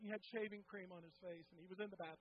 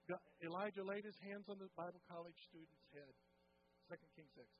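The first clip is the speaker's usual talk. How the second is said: normal talking voice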